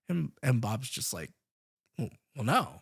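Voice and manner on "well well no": nervous. Retold or imitated. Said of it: "sells this, like, kind of nervousness".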